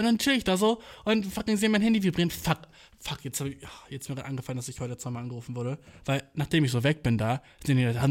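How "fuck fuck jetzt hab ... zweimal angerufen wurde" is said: whispered curse, then quick and soft; a sudden hushed realization mid-story; hushed aside. Real, not acted. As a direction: mutter it fast under the breath.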